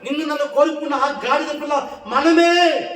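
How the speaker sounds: high, exultant preacher's cry; full-force preaching. Real, not acted. Preacher rousing the congregation about being chosen, full-force preaching. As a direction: proclaim it high, drive up to the last word.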